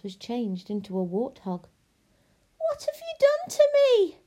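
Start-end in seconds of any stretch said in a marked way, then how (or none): 2.6-4.2 very high, loud, horrified squeal. A narrator's reading. Princess turned warthog confronts witch, squealing. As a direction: squeal it out at the top of your range. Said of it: "squealed the princess".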